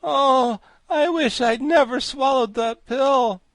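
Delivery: high, loud, slow wail; drawn-out suffering cry; agonized regret. A narrator's reading. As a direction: moan it out in pain. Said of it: "suffer such fearful pains".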